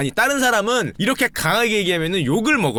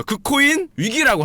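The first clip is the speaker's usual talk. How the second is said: doom prophet; 8 semitones higher, 3 dB louder than usual. Retold or imitated. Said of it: "우리 루비니 형은".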